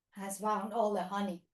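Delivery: ordinary talk, nothing marked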